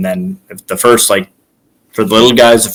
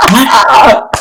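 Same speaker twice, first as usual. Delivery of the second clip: high-pitched incredulous outburst; 4 dB louder than usual. Retold or imitated. Wrestler retelling his reply to being told he's done, incredulous. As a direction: blurt it out in mock disbelief.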